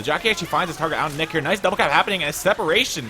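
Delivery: high, loud burst of live casting; excited play-by-play. Real, not acted. Caster calling a double cap as Survivors get separated, excited. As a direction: raise pitch and volume as the play breaks.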